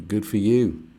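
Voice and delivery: usual speaking voice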